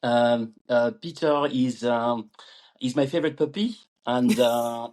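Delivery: hesitant put-on French accent. Shown as a demonstration. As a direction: speak English like a Frenchman feeling for words. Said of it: "I could try my friend, my French".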